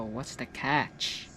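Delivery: drawn out low and quiet, mock suspicious; slow, knowing repeat. Streamer repeats line slowly, quietly.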